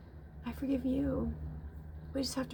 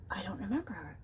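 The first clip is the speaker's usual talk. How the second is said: hushed, falling whisper; whispered worry; 3 semitones lower, 7 dB quieter than usual. Retold or imitated. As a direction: whisper it, unsure and worried. Repeated anxious thought.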